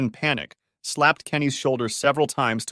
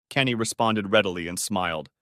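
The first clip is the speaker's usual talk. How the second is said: ordinary delivery, nothing marked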